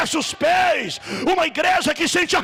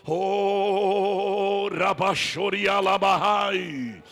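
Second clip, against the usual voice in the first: speaking in tongues; 6 semitones lower, 3 dB quieter, much slower than usual. Real, not acted. Prays in tongues at worship.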